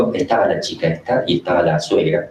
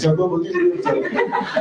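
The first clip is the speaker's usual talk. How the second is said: much higher, squeaky parody dissolving into a laugh; sarcastic mockery. Demon mocking the priest's prayer, squeaky jeering parody. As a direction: jeer at the litany like a taunting demon. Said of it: "son chistes sarcásticos".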